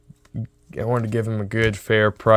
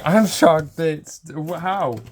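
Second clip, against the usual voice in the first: stunned disbelief; 5 semitones higher, slower than usual. Retold or imitated. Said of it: "I was like".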